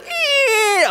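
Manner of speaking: high squeal of annoyance; mock irritated squeal. You overhearing them talk, high irritated squeal. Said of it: "talking to somebody who's like".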